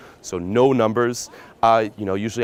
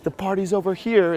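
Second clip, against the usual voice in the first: high, upbeat invitation; fun vibe; 10 semitones higher than usual. Shown as a demonstration. Pickup coach showing the inviting line, fun vibe. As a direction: call her over to the fun. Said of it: "nice, fun vibe".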